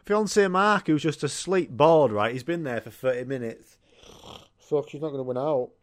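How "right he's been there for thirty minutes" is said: ordinary delivery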